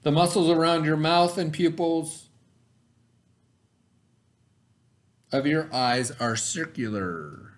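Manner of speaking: put-on clear, deliberate read-aloud voice for kids; reading to children. Teacher reads the science book to her students, storytime voice.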